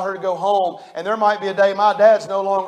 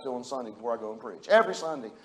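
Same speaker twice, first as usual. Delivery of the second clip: murmured; 7 semitones lower, 9 dB quieter, faster than usual. Shown as a demonstration. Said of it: "y'all wanna hear it?".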